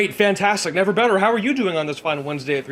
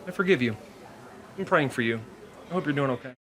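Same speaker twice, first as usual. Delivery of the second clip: mock sincere; 10 dB quieter than usual.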